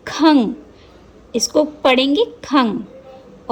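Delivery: खंग slowly with nasal ng ending; slow syllable demo. Shown as a demonstration. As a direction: read खंग slowly, ring the ng. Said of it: "ये अंग की मात्रा". (खंग धीरे से, अंग की ध्वनि के साथ)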